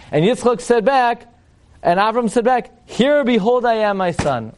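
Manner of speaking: high, stately, stagey reply; mock-theatrical declamation. Rabbi mocking Abraham answering his son, mock-theatrical. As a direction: proclaim it with overdone gravity. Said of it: "what's the meaningful conversation over here?".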